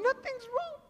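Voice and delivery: very high crying imitation; mock tearful. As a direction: squeak it out as if fighting tears. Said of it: "some people were crying".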